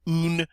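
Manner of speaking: "Un" as a plain "oon"; English-style U. Shown as a demonstration. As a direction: use "oo" in "Un". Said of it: "Do you hear the difference?".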